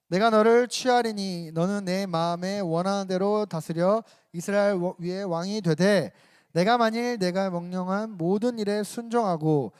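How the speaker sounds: scripture reading aloud. Acted. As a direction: read it aloud together like a congregation. Congregation reads scripture aloud. (다 함께 천천히 크게 성경 봉독)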